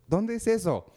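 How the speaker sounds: voz de niño mucho más aguda; niño curioso. Retold or imitated. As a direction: pregunta como un niñito despistado. (voz aguda de niñito)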